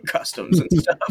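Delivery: high laugh breaking into the words; giggling through it. Real, not acted. Smoking joke.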